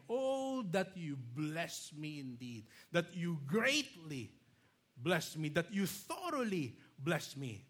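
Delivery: slow, emphatic "bless me" repeated; emphatic plea. Shown as a demonstration. Pastor reading Jabez's prayer, emphatic slow pleading. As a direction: plead each "bless me" with growing weight. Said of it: "That is how emphatic".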